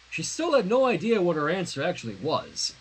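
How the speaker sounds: ordinary delivery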